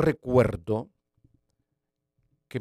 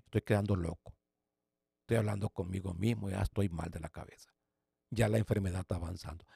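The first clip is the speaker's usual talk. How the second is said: despairing murmur; 4 semitones lower, 11 dB quieter than usual. Retold or imitated. Despairing after crying.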